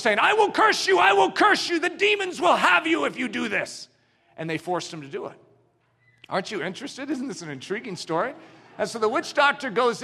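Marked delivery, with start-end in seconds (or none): 0.2-3.9 much higher, shouted curse; cursing threat. Acted. Witch doctor threatens the villagers with demons, shouting high. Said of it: "the witch doctor is cursing".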